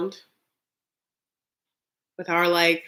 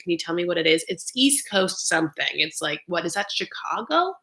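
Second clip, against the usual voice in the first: ordinary delivery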